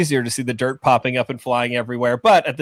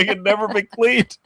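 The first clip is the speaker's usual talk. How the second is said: ordinary talk, nothing marked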